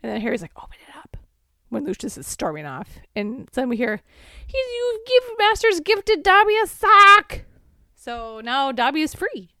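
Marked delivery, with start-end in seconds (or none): 0.6-1.2 hushed urgent whisper. Retold or imitated. Harry whispering to Dobby. 4.5-7.4 high, loud squeaky elf; Dobby voice. Retold or imitated. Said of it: "You like my Dobby?".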